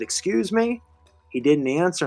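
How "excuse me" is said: high, sharp startled question; retold startled "excuse me". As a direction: pop up in pitch, caught off guard. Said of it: "I said".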